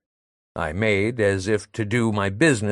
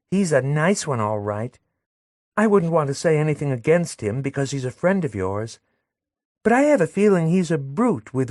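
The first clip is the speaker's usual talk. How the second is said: put-on higher, confiding young woman; Madelon gossiping; 7 semitones higher than usual. Madelon gossiping about the doctor to Léon, higher confiding voice.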